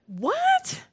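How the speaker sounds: a startled what, pitch leaping up; stunned what. Retold or imitated. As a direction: hit by the news, cry it out high. Hearing that Kathy is dying.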